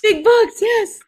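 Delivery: high, loud game show cheer; excited cheer. Pretend. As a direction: shout it like a contestant.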